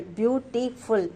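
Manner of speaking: "Beautiful" in three separate beats, beau-ti-ful; broken into syllables. Shown as a demonstration. Teacher illustrating syllables for a class, "Beautiful" split into beau-ti-ful. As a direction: softly count out the syllables of "Beautiful". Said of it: "that is considered as one syllable".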